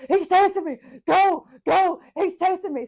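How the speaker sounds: high, loud mock-panicked yelling; hammed-up frantic shouts to a getaway driver; Kevin in panic. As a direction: cry out like you're being chased. Retold Kevin fleeing in panic.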